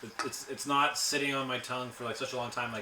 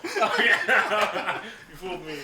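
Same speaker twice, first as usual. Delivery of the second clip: laughing; 6 semitones higher, 4 dB louder than usual. Real, not acted. Fooled by fake accent.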